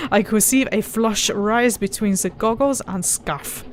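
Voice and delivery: ordinary talk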